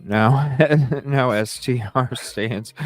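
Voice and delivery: laughing through the words. Real, not acted. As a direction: chuckle while reading on. Laughs while restarting the reading.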